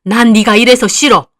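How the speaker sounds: loud, cutting rejection; angry mother. Acted. Mother telling her daughter she dislikes her, loud and cutting. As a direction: tell her flatly you hate her.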